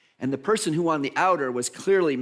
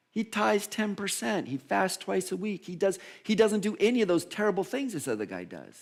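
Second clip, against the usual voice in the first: higher-pitched mock praise; mock admiration; 7 semitones higher than usual. Preacher pleading the Pharisee's good record, mock admiration. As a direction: talk up his good deeds in a raised pitch.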